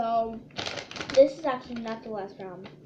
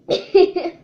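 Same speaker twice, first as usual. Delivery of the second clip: loud laugh; 5 semitones higher, 13 dB louder than usual. Real, not acted. Laughs after the fiery bite.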